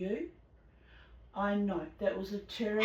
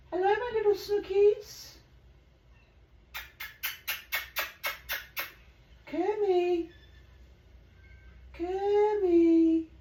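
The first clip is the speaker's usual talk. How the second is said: much higher, slow crooning pet voice; 10 semitones higher, 6 dB louder, slower than usual. Real, not acted. Owner coaxing her bird in high baby talk. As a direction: croon up high to coax him over.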